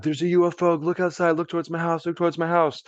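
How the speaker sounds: high, urgent yell without real volume; mock screaming. Kid phoning friends about a UFO, high mock scream. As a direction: re-enact frantic screaming down the phone, held back. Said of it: "I'm on the phone and I'm screaming".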